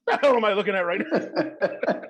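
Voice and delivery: bewildered tough guy, cracking up as you say it; laughing gruff guy. Retold or imitated. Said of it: "And he's like".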